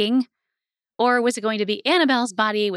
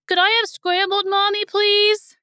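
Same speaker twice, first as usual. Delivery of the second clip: put-on pleading little boy; 11 semitones higher, 4 dB louder than usual. A narrator's reading. Little brother asking Mommy for scrambled eggs, high pleading child voice.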